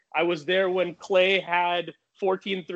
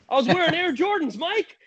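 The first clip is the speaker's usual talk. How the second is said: much higher and quicker, a sheepish admission; sheepish high reply; 11 semitones higher than usual. Retold or imitated. Starstruck teen tells Michael Jordan his shoes, high and sheepish. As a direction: squeak it out like a starstruck teen caught out.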